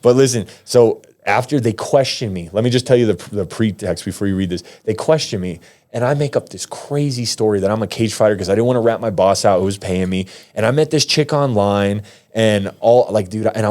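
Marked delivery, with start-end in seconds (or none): none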